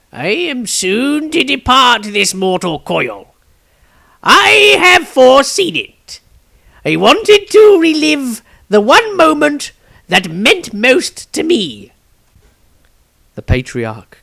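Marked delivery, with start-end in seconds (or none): 0.1-11.9 high, slow and louder aged voice; an ancient man calmly facing his end, pitched high; grave old wizard. Acted. Old wizard telling the Patriarch he will soon die, high and slow. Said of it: "smiled gravely".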